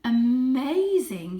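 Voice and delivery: slow, loud, drawn-out purr of wonder; father cat marvelling at the toy, purring slowly. Acted. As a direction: savour the word like a purr. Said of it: "he purred".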